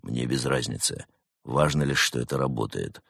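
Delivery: icy agent, unmoved by protest; cold. A narrator's reading. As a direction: dismiss him coldly in a low voice. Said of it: "холодно ответил брат Немрод".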